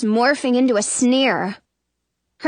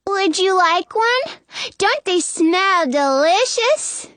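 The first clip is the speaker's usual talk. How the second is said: put-on high little-girl voice, slowing; 7 semitones higher, slower than usual. A narrator's reading. Disguised queen shoves plate at Snow, little-girl voice. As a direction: coax sweetly in a high child's voice.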